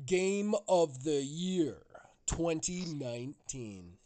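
slow, loud announcer build-up; suspenseful awards presenter. Acted.

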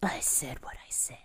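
hushed dismissive whisper. Acted. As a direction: whisper it flatly. Stubborn character retort.

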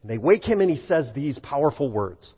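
ordinary talk, nothing marked

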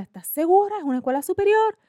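mock incredulous questions, pitched high and loud. Job caller double-checking with the applicant, incredulous.

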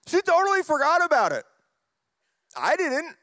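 laughing. Real, not acted. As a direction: chuckle your way through it in disbelief. Laughing about daughter forgetting.

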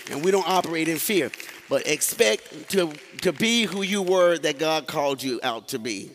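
preaching. Acted. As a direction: preach it out to the congregation, building. Preaching build-up to congregation.

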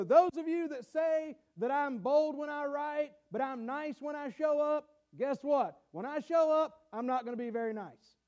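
emphatic mock challenge from the pulpit; voiced warning. Voices Paul's warning to critics.

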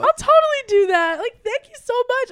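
very high, loud, eager acceptance; gushing agreement. Retold or imitated. Customer invited to hang out by barista, gushing. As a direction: gush it out, imitating your flustered self.

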